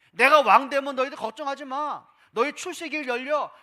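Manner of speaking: a pretend messiah-king promising his followers, loud and triumphant; proclaiming king. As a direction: announce it like a conquering king. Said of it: "그런 소망의 메시지".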